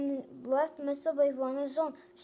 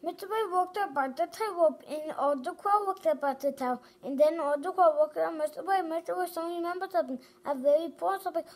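ordinary delivery

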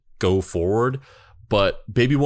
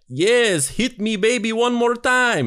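high, hammed-up fake Swedish accent; a bad Swedish accent, much higher; 13 semitones higher, slower than usual. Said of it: "That's not a Swedish accent at all".

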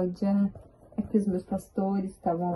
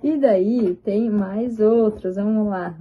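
ordinary delivery

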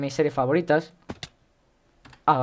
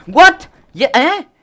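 loud, high-pitched startled "what"; real shock, voice jumping up; startled yell; 12 semitones higher, 12 dB louder, much slower than usual. Real, not acted. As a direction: yelp in sudden disbelief. Startled by sudden game event.

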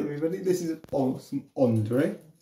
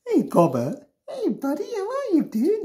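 very high cooing voice. Real, not acted. Cooing at ferret.

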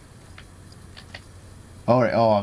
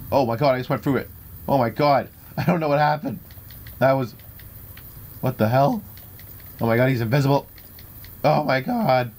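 raised, high-pitched disbelief; bewildered alarm; 4 semitones higher than usual. Real, not acted. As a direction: blurt out shock as the game goes haywire. Baffled by portal and invisible foe.

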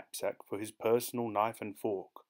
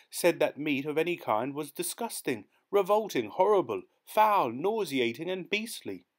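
much higher, louder list, slowing on foul; building indignation; 7 semitones higher, 4 dB louder than usual. Acted. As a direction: pile the words up in outrage. Listing aunt's disgust words escalating.

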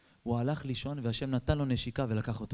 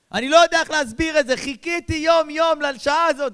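much higher, louder rapturous sermon; ecstatic preaching; 9 semitones higher, 11 dB louder than usual. Acted. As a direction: soar with joy as you preach. Preacher exalts at full force. (התלהבות דרשנית בקול רם וגבוה)

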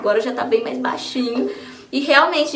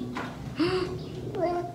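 a sharp, quiet gasp of surprise at the thunder; soft real gasp, startled by thunder; 5 semitones higher, 12 dB quieter than usual. Real, not acted. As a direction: catch your breath at a sudden thunderclap.

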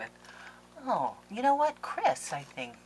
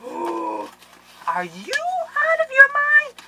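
much higher, very slow stretched shriek; shocked disbelief; 9 dB louder, much slower than usual. Real, not acted. Vlogger stunned by a craft gift, slow high shriek. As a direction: draw it out in stunned delight.

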